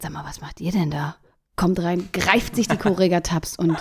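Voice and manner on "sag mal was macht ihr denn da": soft, whispered Sag mal; retold Peter, asking in a hush; whispered question. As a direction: Peter whispering to the three women. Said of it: "kommt Peter runter und sagt".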